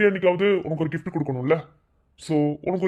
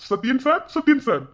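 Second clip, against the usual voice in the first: high, insistent call to get attention; 7 semitones higher, 3 dB louder than usual. Acted. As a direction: call him twice, pitched up, second louder. Interrupts scene, calling Satyan sir. (கவனத்தை ஈர்க்க உரக்க அழைத்தல்)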